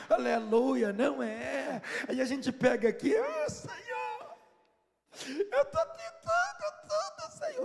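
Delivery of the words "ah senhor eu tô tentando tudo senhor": very high, slow, wailing "Ah, Senhor"; mock a believer begging God in a long whine; whiny desperate prayer. (oração chorosa e exagerada, aguda e arrastada)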